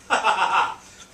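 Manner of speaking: big real burst of laughter; laughing at his own boldness; loud laugh. Real, not acted. Laughing after the Connaught line.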